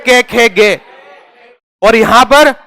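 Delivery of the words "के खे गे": के, खे, गे repeated with an e ending; Haryana-style ke khe ge. Shown as a demonstration. Teacher repeats के, खे, गे with the e ending. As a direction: say के, खे, गे again, each ending on e. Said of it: "कैसे बोलते हैं?".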